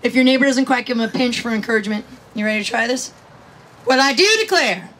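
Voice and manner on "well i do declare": loud, showy holler to the crowd; projected catchphrase; called catchphrase cue. Pretend. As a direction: belt out the cue for the audience.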